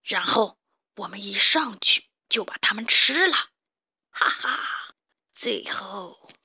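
husky, hushed villain plotting; scheming mole boss. Acted.

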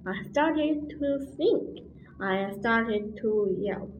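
plain reading voice

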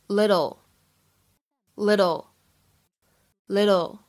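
"little" with the double T as a quick flap, like "liddle"; American flap T. Shown as a demonstration. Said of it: "double T是非常典型的闪音的处理". (美式闪音读"little"，tt轻弹一下)

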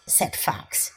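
ordinary delivery

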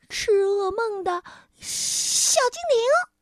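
high, squeaky, stuttering excitement; little nightmare-eating sprite. A narrator's reading. Sprite tells the king they are saved, excited high stutter. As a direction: stammer in a tiny voice, building to a proud finish. Little man reveals who he is.

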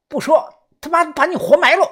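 high, loud menacing yell; fierce threat. A narrator's reading. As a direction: threaten to bury him alive, shouting. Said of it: "眼神比较凶狠".

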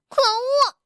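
high angry outburst. Acted. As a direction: burst out crossly, pitched high. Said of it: "蹦蹦生气地说". (生气地高声喊)

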